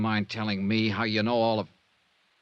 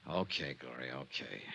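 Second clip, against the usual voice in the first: soft soothing okay; 8 semitones lower, 12 dB quieter than usual. Acted. Johnny soothing Gloria.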